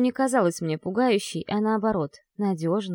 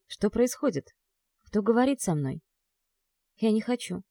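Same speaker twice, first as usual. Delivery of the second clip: plain reading voice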